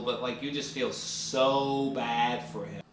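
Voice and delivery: ordinary talk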